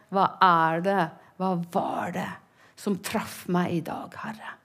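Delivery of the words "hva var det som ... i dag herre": soft, hushed prayer; whispered prayer. Acted. Asking the Lord what touched her today, whispered. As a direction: pray it under your breath.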